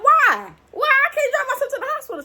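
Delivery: incredulous protest. Retold or imitated. Daughter protesting to her mom that she can drive herself, high and incredulous. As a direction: protest like a grown daughter being told no. Arguing with mom about driving.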